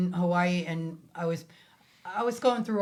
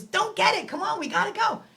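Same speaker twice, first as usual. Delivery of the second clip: high urging friend voice; 13 semitones higher, 4 dB louder than usual. Retold or imitated. Friend Alicia urging her to skip the phone call. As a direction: voice the impatient friend, pitched up. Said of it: "She goes".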